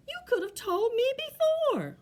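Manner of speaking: high, exclaimed complaint; protesting. Acted. Bear answers the tree back, high protest.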